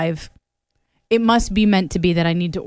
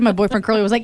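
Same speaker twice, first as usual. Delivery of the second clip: normal talking voice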